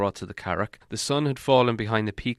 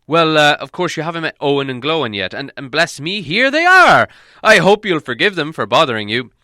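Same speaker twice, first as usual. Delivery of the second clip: higher, louder call to the dwarves; wizard calling out; 6 semitones higher, 9 dB louder than usual. Acted. Gandalf calling the hidden dwarves out, high and loud. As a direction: summon them in with a lift.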